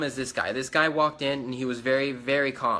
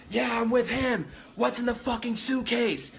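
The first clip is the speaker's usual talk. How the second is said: high-pitched, strained demand without extra volume; agitated bystander; 9 semitones higher than usual. Retold or imitated. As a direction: imitate a worked-up man butting in. Another guy runs up, demanding.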